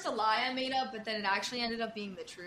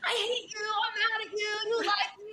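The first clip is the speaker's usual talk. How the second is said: much higher whiny patient voice; imitating a furious patient, high and whiny; mock angry patient; 11 semitones higher, 4 dB louder than usual. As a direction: play the sulking patient. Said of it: "She's like".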